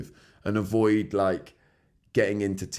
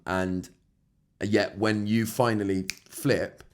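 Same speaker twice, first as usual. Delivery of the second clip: ordinary talk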